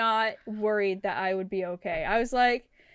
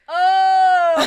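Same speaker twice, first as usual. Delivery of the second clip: a loud high startled shout of oh; 6 semitones higher, 12 dB louder than usual. Retold or imitated. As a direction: jolt with sudden realization. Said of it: "I'm like".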